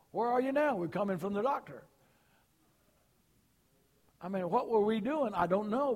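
high, loud, fast storytelling from the pulpit; lively preaching. Acted. Pastor amusing the congregation about doctor visits, loud and quick. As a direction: rattle off the doctor visits for laughs.

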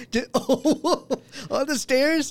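high, slow words through laughter; cracking up while asking; laughing disbelief. Real, not acted.

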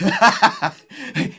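loud, high burst of laughter; short, high-pitched belly laugh. Real, not acted. Laughs after quoting joy verse.

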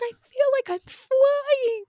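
very high, slow, stretched-out joy; imitated elated child. Mom imitating daughter riding with arms out, high elation. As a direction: float it up high and draw it out.

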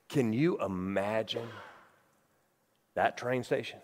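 low and soft, drawing the room in close; quiet, heavy storytelling; hushed sermon voice. Acted. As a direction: bring it down to a grave hush. Preacher drops to a hushed retelling.

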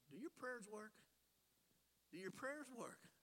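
ordinary delivery

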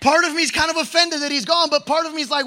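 ordinary talk, nothing marked

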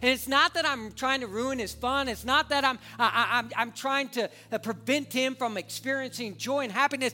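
loud, high impassioned sermon; preaching at full force. Acted. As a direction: preach it to the back row. Preaching about his son.

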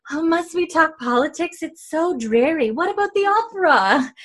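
high, airy society-lady impression; Louise. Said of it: "And Louise is like".

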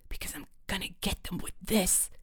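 conspiratorial whisper; hushed, voiceless and sly. A narrator's reading. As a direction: whisper the plan to your friend. Friends hiding, whispered scheme.

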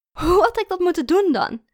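loud, high scoffing question; incredulous. A narrator's reading. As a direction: challenge his absurd idea. Girlfriend scoffs at absurd reproach.

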